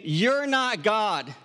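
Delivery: You're not God, each word slow and weighted; Jesus pressing the point to worriers, slow emphasis; slow emphatic. Retold or imitated. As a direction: land it slowly and firmly. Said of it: "It's as if he is saying".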